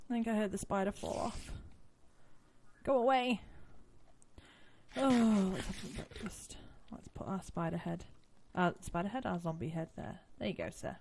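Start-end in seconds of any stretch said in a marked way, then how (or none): none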